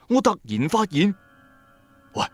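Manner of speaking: ordinary talk, nothing marked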